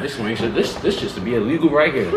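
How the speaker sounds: talking while chewing; savouring food mid-bite; mouth full of chicken. Real, not acted. Seen 0: Bit wing at -5, savours with jaw working, wing still in hand.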